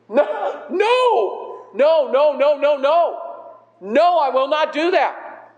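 high, rapid string of protesting no's; mock-appalled, insistent refusal; Peter's horrified refusal. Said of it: "imagine this for Peter".

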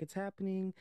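a touch of acted dread; mild mock panic. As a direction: lightly act out rising alarm. Retold panicked warning.

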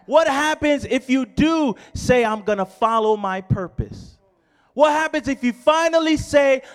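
loud, high, insistent preaching; full-force preaching. Acted. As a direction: build urgent repeated questions to the congregation.

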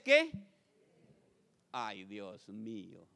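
ordinary talk

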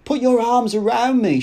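high, loud, eager demand; insistent, lifted voice; amorous command. A narrator's reading. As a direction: order a lover into an embrace. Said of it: "she commanded".

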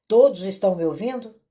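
loud and fast, checking if anyone hears; calling out to the group. Real, not acted. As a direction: call out over a bad line. Checking audio on failing call.

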